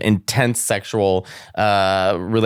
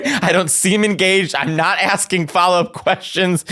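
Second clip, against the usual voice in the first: laughing retold rant; 7 semitones higher than usual. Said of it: "I stopped and said".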